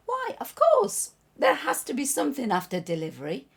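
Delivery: high, emphatic storybook exclamation; confident believer. Acted. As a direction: answer loudly, pitch leaping up with certainty. Acting confident baby in parable.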